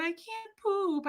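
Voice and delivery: put-on high, soft, drawn-out child's whimper; slow, pitiful high wail; mock bawling. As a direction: imitate a tearful kid on the phone. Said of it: "and I'm bawling".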